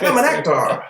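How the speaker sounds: high, hammed-up old movie actor; mock actor voice. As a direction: imitate an old-timey thespian announcing themselves. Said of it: "They're all like".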